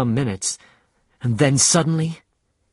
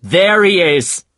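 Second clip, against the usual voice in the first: high, loud, slow shout; 7 semitones higher, 8 dB louder, slower than usual. Acted. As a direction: call it out as the whale is sighted. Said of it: "shouted Captain Ahab".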